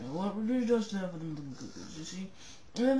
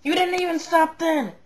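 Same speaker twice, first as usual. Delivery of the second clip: angry shout; 10 semitones higher, 13 dB louder than usual. Acted. Angry complaint.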